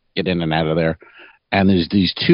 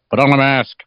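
high thrown voice under the breath; ventriloquist voice; 5 semitones higher than usual. Retold or imitated. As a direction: mutter it like a ventriloquist. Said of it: "he's throwing his voice towards you".

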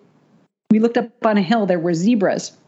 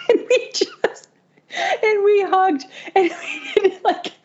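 much higher, slow teary laugh; laughing through tears; 13 semitones higher than usual. Real, not acted. Recalling tearful hug.

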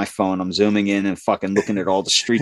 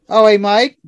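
higher, chirpy dispatcher greeting; mimicking dispatcher; 9 semitones higher, 5 dB louder than usual. The late dispatcher greeting him, higher and chirpy. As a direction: breeze in higher, like she's done nothing wrong. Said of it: "she gets back".

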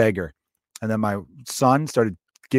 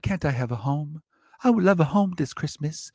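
pleading little orphan voice, pitched up; orphan Timmy; 5 semitones higher, 3 dB quieter than usual. Acted. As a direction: put on a small, wistful orphanage kid. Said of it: "that's how all Timmys sound".

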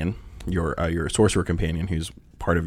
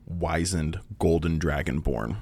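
ordinary talk, nothing marked

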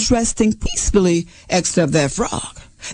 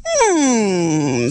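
long low falling hum; a hint of a deliberating fox; pondering fox; 5 semitones lower than usual. A narrator's reading. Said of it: "said the fox".

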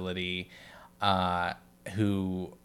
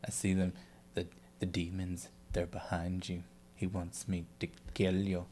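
very soft, halting imitation with long pauses; quiet mock-creepy voice, word by word; possessed interviewee; 4 semitones lower, 12 dB quieter than usual. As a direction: imitate a haunted patient, hushed and chilling. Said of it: "like, when he's like".

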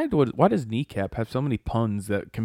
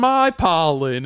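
normal talking voice

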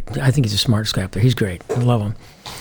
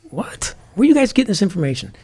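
incredulous; 8 semitones higher than usual. Retold or imitated. Disbelief at hooker rumor.

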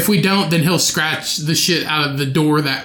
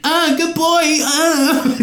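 cooing at dog; 12 semitones higher, much slower than usual. Real, not acted. Talking to the dog.

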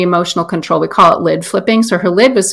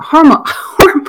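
laughing; 8 semitones higher, slower than usual. Real, not acted. Stumbling over word, laughs.

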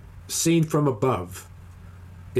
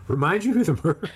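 laughing in disbelief, voice lifted; high, breaking into a laugh; incredulous laughing; 5 semitones higher than usual. Real, not acted. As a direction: laugh through an astonished echo of the question. Disbelief at co-host forgetting the murderer.

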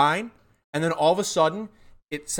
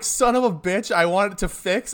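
annoyed cursing at the mic; mock frustrated outburst, higher; fed-up groan at the tech; 7 semitones higher, 3 dB louder than usual. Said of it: "Part of me is like".

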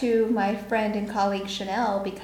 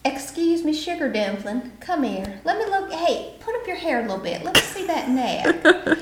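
1 sweet Southern lady vampire; 7 semitones higher than usual. Pretend.

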